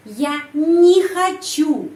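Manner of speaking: не pronounced "ni", drawn out high and loud; slow, emphatic model of не as "nee". Shown as a demonstration. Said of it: "pengucapannya ni".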